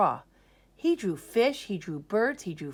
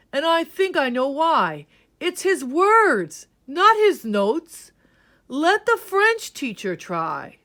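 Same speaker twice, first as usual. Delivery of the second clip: loud emphatic band leader; 8 semitones higher, 8 dB louder than usual. Acted. Band leader insists the French teacher must try, loud. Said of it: "he said".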